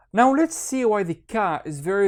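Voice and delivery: ordinary talk, nothing marked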